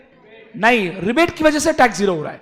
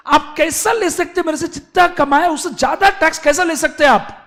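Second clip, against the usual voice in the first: higher, louder protest; mock indignant protest; 7 semitones higher, 6 dB louder, faster than usual. Taxpayer protesting to the officer, mock outraged. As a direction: demand to know how they can take more. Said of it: "Then the person is telling".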